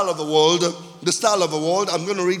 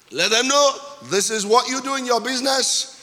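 raised, higher-pitched pulpit voice; impassioned preaching; 5 semitones higher, 4 dB louder than usual. Real, not acted. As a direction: drive each line home to the congregation.

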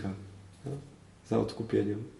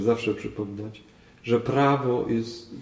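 ordinary talk, nothing marked

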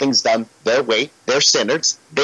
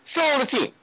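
high, disbelieving repeat; surprised that the keeper is still on the team; incredulous echo; 10 semitones higher, 3 dB quieter than usual. Real, not acted. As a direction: squeak it back in disbelief.

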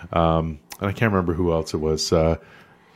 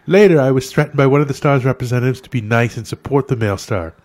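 put-on accent drifting Irish, higher; mock Irish-sounding accent; 4 semitones higher, 4 dB louder than usual. Host reads a celebrity's tweet aloud in a slipping Irish accent. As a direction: read the tweet in a wobbly Irish-leaning accent. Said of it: "That sounds more Irish".

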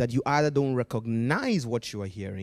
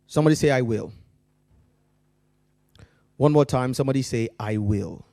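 preaching call and response; 6 dB louder, faster than usual. Acted.

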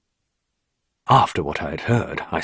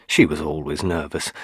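plain reading voice

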